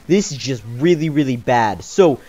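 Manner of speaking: ordinary delivery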